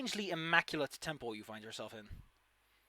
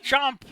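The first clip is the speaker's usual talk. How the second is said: shouted, high chomp; loud chomp; 11 semitones higher, 16 dB louder than usual. Pretend.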